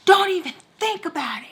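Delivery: high, sharp mock warning from the staff woman; stern warning. To the girl trying to leave, a high stern warning. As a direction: bark it like a strict guard stopping her.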